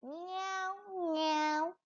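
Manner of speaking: a soft little kitten mew; teacher mewing quietly for the children; cat meow imitation. Shown as a demonstration. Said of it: "Kucing make".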